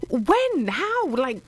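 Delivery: high-pitched, bewildered string of questions; retold disbelief. Reader re-enacting news of a death, bewildered disbelief.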